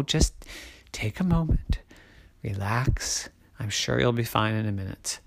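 soft, low reassurance; calm him down gently and quietly; soothing mommy. A narrator's reading. Mommy soothes dizzy daddy.

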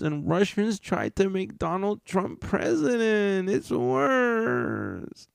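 high, sugary mock politician voice; mocking Jen Psaki. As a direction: parody a condescending press secretary. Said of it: "I'll sum it up in a stupider way".

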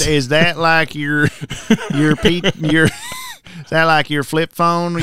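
slow, laughing hillbilly drawl; mock hillbilly accent. Mocks co-host as old-timer.

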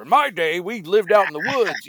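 put-on high, loud old-timer reminiscing; grandfather voice. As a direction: play a crusty old grandpa. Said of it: "I sound like a grandfather".

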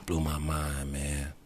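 ordinary talk

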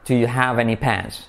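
slow, measured "Do you have any pens"; unhurried teacher's model with a falling end; careful model. Shown as a demonstration. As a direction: lay out the question slowly, falling on "pens". Plain model before intonation version.

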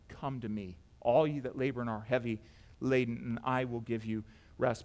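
very soft, low voice of comfort; hushed and low, a tender invitation; gentle invitation. Acted. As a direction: call them in tenderly, almost under your breath. Jesus gently calling people to come.

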